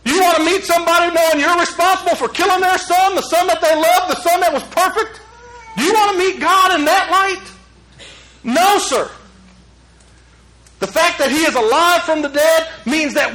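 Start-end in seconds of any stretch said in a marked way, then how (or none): 0.0-9.1 impassioned pleading. Real, not acted. Preacher pleading with the congregation.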